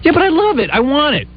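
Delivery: high-pitched indignant protest; worked-up whiny insistence. Retold or imitated. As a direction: plead your case, insistent and high. Retells his own protest in argument.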